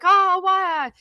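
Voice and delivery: high-pitched pretend thrill; mock excited surprise. Birthday person hearing of a gift, mock excited surprise. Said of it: "You're all excited".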